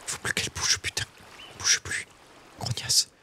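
soft, near-whispered grumble at the prey; hushed hunting mutter. Real, not acted. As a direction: keep your voice down while stalking. Stalking prey in the game.

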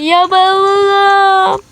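drawn-out crying wail over her lost suitcase; fake sobbing. Girl wailing to her friends about her suitcase, sobbing.

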